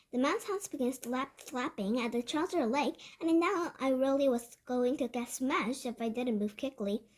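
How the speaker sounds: usual speaking voice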